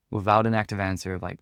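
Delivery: ordinary talk, nothing marked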